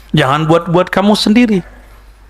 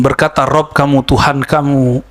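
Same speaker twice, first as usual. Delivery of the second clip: ordinary talk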